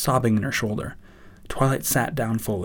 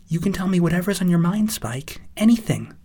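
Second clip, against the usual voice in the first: encouraging Twilight; 8 semitones higher, 5 dB louder than usual. Acted.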